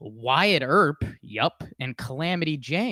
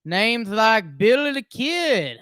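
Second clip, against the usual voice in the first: raised, slow storyteller read; dramatic narration; 9 semitones higher, 6 dB louder, slower than usual. Acted. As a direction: announce the names grandly. Intro narration.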